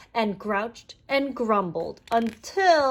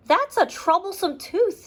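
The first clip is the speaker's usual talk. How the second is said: higher, friendly farm donkey; 8 semitones higher than usual. Acted. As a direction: voice the helpful donkey. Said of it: "said Derry the donkey".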